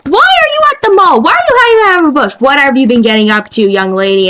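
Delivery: high, fast mock-parent voice; squeaky scolding dad impression; prying dad quizzing about the mall. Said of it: "looks at the T V and says".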